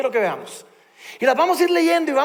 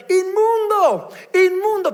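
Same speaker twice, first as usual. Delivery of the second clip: leper's cry; 6 semitones higher, 4 dB louder, slower than usual. Retold or imitated. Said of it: "él tenía que gritar".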